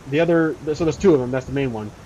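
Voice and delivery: normal talking voice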